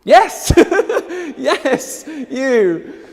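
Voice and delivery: high, loud, laughing announcement; excited. Real, not acted. Host cheering the audience vote winner, excited.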